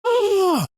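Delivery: very high, loud mock yell; triumphant shout. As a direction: belt out a victory cry. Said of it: "he goes".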